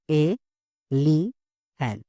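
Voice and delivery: "ए, ली, hand" as three spaced beats; syllable by syllable. Shown as a demonstration. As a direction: leave a clear pause after each of "ए, ली, hand". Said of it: "example देखते हैं".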